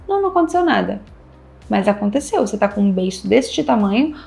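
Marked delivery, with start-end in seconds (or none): 0.1-1.0 higher, pouting denial; sulky denial. Retold or imitated. Girlfriend pouting to boyfriend, high mock sulk. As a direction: insist nothing happened while pouting. Girlfriend sulking, acted quote.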